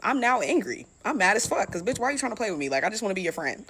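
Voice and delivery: ordinary delivery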